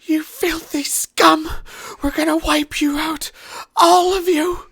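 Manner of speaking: shrieking high and loud, spitting hate; hysterical screaming. Acted. Captive prisoner shrieking threats at his captors, hysterical scream. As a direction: scream every threat at the top of your lungs. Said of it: "he just starts screaming hysterically".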